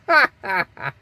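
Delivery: quick burst of amused laughter; real laugh. Real, not acted. Laughing at his own remark.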